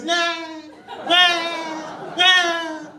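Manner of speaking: far-off yell from a passing car; high shouted nahs fading past; a driver yelling out his window as he speeds by; drive-by shout. Retold or imitated. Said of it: "So all I hear is".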